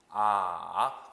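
"아" dipping low, then rising; third tone. Shown as a demonstration. Teacher modelling the third tone, low dip then rise. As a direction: scoop "아" down and swing it back up. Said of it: "삼 성".

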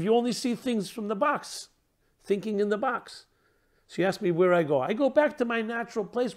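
high-pitched, indignant putdown; incredulous indignation. Acted. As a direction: lecture the little box as offended electricity. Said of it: "responds with an incredulous indignation and tone".

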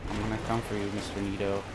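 low, soft muttered threats; near whisper, calm. Real, not acted. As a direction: keep it hushed and low to stay calm. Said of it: "I'm sorry if I'm whispering".

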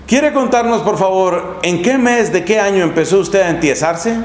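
ordinary talk

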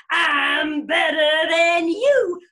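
very loud, drawn-out taunt; triumphant bear. Acted. Bear declaring victory over rabbit, gloating. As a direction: stretch every word in high, gloating triumph.

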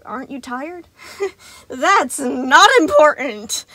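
put-on high, wired, overtired child-minder voice; an exhausted robot insisting it's fine; Sun the manic animatronic. A narrator's reading. Sun brushing off Gregory, high and wired.

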